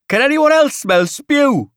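high, loud Geordie complaint; strong Geordie accent, pitched right up; Paulie. A narrator's reading. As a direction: call it out in Geordie disgust. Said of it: "says Paulie".

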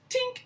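tiny very high "tink"; imitated fist-bump chime. Two wizards bumping fists, tiny high chime. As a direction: squeak out a little clink. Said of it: "it's literally just like".

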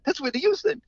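high, astonished recognition; amazed exclaim. Retold or imitated. Caterer sees [name] wave from the limo, high amazed exclaim. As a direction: gasp it out in disbelief, high.

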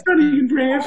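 high put-on woman's voice; girly voice. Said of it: "You gotta use the chick voice".

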